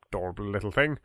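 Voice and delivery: usual speaking voice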